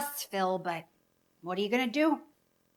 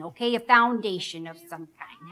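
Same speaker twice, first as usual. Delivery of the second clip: ordinary talk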